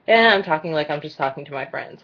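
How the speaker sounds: ordinary talk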